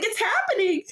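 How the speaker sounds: high, thrilled whisper-squeal; excited squeal. Retold or imitated. Reliving the start of the game, high thrilled squeal. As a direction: savor it, thrilled. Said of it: "I was just like".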